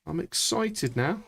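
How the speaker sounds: drawn-out rising excited; slow rising excitement. Shown as a demonstration. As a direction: let the excitement creep in.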